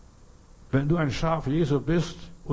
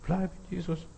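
hushed, higher-pitched gentle urging; soft encouragement; 4 semitones higher, 8 dB quieter than usual. Acted. Preacher encouraging believers to stay, hushed and tender. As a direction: say it softly, nearly under your breath. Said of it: "ermutigt werden".

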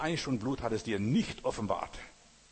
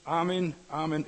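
louder, higher amen; emphatic amen; 5 semitones higher, 4 dB louder than usual. Retold or imitated. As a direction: break in with amen.

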